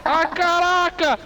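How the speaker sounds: high, slow drawn-out wail; shocked cry. Real, not acted. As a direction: stretch out the shock. Crash in game.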